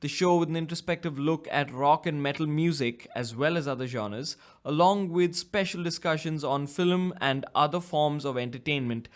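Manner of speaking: bright, upbeat podcast host opening; lightly announcer-style show opener; show host intro. Acted. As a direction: welcome listeners like a radio host.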